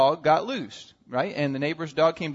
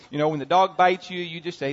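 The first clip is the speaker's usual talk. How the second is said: normal talking voice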